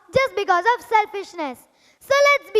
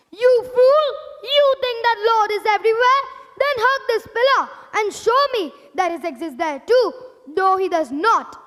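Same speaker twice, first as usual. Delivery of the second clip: scornful taunt; 2 semitones higher than usual. Acted. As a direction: jeer at the boy. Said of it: "his cruel father".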